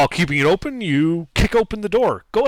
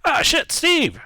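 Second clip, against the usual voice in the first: surprised guard; 13 semitones higher, slower than usual. Acted.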